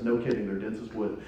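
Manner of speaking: low, soft muttered aside; quick grumble under the breath; mumbling under breath. Acted. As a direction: mutter it as if not meant to be heard. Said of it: "Jesus mumbles under his breath".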